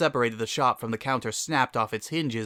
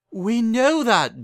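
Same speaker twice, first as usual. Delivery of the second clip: placating mother; 11 semitones higher, 6 dB louder, much slower than usual. Acted.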